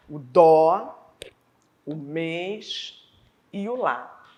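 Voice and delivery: "dó", "mês", "lá" each held with full stress; strong stressed monosyllables. Shown as a demonstration. Teacher giving examples of tonic words, strongly stressed. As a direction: land firmly on each noun, pausing between. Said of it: "Todos esses são pronunciados de forma forte".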